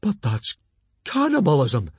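loud, rising shocked protest. Acted.

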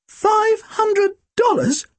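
very high, slow squeak of disbelief. Acted. Tim squeaks back the sum in shock, very high. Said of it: "Tim squeaked".

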